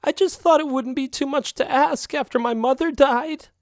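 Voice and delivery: high, tearful wail; crying. Acted. Girlfriend cat crying to her partner about her mother, high tearful voice. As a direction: break into staged tears, voice way up. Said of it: "Then the crying began".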